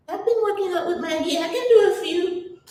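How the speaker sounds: high, quick, pleased boast; mock proud patient. Patient proudly answering the doctor, high and quick. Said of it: "You're like".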